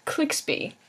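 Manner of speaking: crisp definition reading; reading aloud. Acted. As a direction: read each entry out clearly. Reads dictionary definitions aloud.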